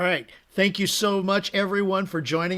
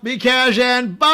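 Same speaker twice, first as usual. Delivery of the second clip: big sing-out farewell; cheery sign-off shout; 4 semitones higher, 6 dB louder than usual. Real, not acted. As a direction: call it out to the whole crowd. Calling out the sign-off.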